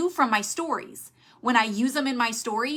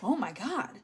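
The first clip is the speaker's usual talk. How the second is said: softer, mildly dismayed groan; overwhelmed sigh; 6 dB quieter than usual. Retold or imitated. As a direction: sound buried under too much info. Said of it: "They're like".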